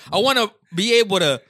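ordinary delivery

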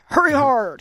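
loud bark of hurry hard; curling skip yelling. Retold or imitated. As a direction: yell it down the ice like a skip. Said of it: "they yell a lot".

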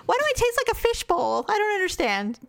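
high, bewildered hungover whine; mock hungover self. Cohost acts out a hungover morning, high confused whine. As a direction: play the groggy drinker baffled by the taste. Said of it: "You're like".

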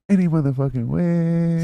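high, drawn-out catchphrase; playful catchphrase. Pretend. Drawn-out catchphrase twist on anyways.

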